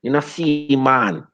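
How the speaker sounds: high-pitched mock Jamaican accent; drawn-out, high parody of a Jamaican accent; bad Jamaican accent. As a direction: mimic a terrible fake Jamaican accent. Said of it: "his Jamaican accent was what really gave it away".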